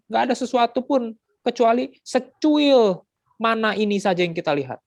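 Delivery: high, loud complaining crowd; whiny shouting. Acted. Israelites crying out to Moses for meat, loud and whiny. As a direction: wail for meat like a greedy mob. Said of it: "mereka teriak".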